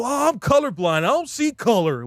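high-pitched mock innocence; mimicking naive objectors. Preacher quoting people who claim colorblindness, high mocking imitation. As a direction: put on a high voice for the excuse-makers.

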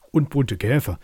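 loud, quick beetle shout-in; beetles calling out. A narrator's reading. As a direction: blurt it eagerly like beetles. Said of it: "riefen die Käfer schnell".

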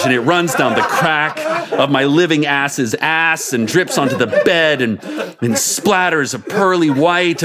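reading through real giggles; can't keep a straight face, laugh through the words; laughing while reading. Real, not acted. Narrator cracking up while reading.

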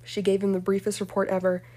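plain reading voice

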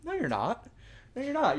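high-pitched mock rebuttal; self-correction. Preacher answering his own first thought, high-pitched contradiction. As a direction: contradict your own inner voice, pitch jumping up. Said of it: "then I was like".

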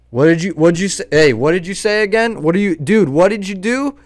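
loud, high mock challenge; imitating confronting man. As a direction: demand answers like an agitated accuser filming. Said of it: "that's what he was doing to me".

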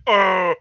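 mimicked whale groan, loud; imitated whale bellow. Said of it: "fucking Whale's like".

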